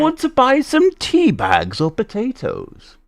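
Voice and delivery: high, slow swooping merchant voice; eccentric shopkeeper. Acted. Shopkeeper offers tea bags to a customer, high and slow. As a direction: swoop high and stretch out the question. Plays shopkeeper selling to viewers.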